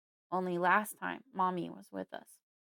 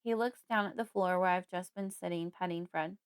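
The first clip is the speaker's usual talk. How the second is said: plain reading voice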